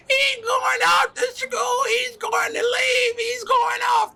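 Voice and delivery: loud, very high tearful wail; mock-bawling interjection; hammed-up wailing mother. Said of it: "then she interjects".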